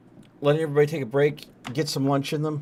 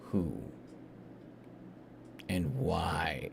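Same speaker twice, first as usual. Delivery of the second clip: hushed, low, ominous whisper; dramatic whisper; 6 semitones lower, 10 dB quieter, much slower than usual. Pretend. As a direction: whisper it like a secret. Dramatic reveal of a mystery.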